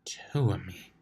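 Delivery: soft unvoiced whisper to herself. Twilight whispering to herself on the way home. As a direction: breathe it as a private realization.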